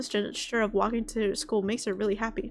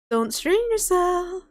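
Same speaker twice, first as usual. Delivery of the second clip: slow, much higher and louder call after him; 9 semitones higher, 6 dB louder, much slower than usual. Acted. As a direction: call out across the room, drawn out. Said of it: "Monika calls that out".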